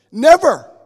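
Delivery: big loud rising shout of "Never"; preacher's booming "Never" cry. Acted. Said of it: "God is never mad at us".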